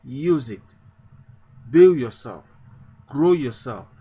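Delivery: usual speaking voice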